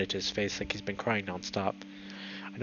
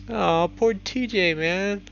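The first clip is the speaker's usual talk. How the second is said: sympathetic aw; 10 semitones higher, 6 dB louder, much slower than usual. A narrator's reading. Sympathy for friend.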